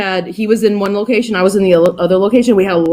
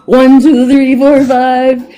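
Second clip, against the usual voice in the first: counting out louder and higher; louder mic check; 4 semitones higher, 4 dB louder, much slower than usual. Retold or imitated. Asked to redo the mic check, she counts out louder. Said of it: "And they're like, "No, no, no".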